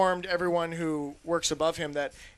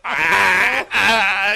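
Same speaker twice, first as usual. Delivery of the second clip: loud panicked yelps; hammed-up alarm cries; mock scream; 9 dB louder than usual. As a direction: yell out in mock fright.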